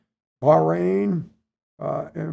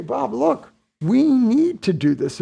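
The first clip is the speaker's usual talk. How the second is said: boss insisting; 8 semitones higher, 4 dB louder, slower than usual. Retold or imitated. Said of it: "he says to me".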